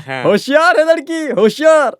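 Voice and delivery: high, exclaiming approval; delighted praise. Acted. Uncle praising niece's right guess, delighted.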